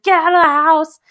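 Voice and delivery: high-pitched retold outburst; mock furious. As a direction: act out throwing someone out in anger. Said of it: "He's so mad. He's like".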